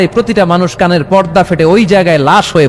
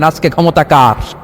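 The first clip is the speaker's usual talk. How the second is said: ordinary delivery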